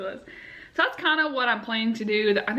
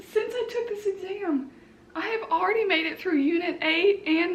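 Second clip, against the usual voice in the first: high-pitched, near tears, rushing; giddy disbelief; 7 semitones higher than usual. Real, not acted. Vlogger telling viewers she passed, high giddy disbelief. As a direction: voice up high, on the edge of crying.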